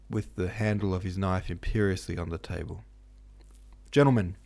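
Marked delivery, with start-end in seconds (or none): 3.9-4.4 loud, higher call to order; firm, ringing address; stern. A narrator's reading. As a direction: command attention sternly. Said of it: "he said in a stern voice".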